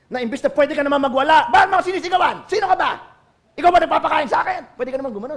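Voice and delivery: mock angry retort.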